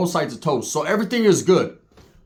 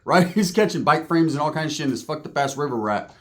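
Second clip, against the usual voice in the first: usual speaking voice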